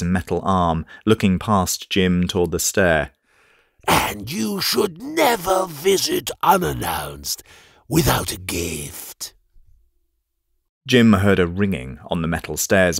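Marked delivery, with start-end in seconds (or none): 3.9-9.3 slow, high, silky mage; a sly old mage greeting his prey, slowly; Node's voice. Acted. Mage greets Jim with slow, high, silky menace.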